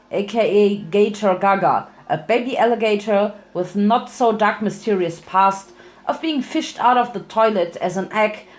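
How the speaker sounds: ordinary delivery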